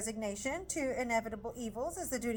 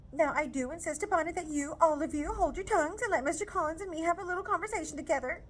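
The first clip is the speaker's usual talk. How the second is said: higher, louder, indignant scolding; scolding mother; 7 semitones higher, 4 dB louder, faster than usual. Acted. Mrs Bennet scolding Lizzie for refusing, loud indignant scold. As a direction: scold the daughter hard.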